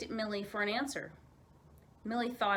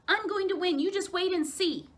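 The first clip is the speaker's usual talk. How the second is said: determined shout; 10 semitones higher, 6 dB louder than usual. Acted. Determined child racing.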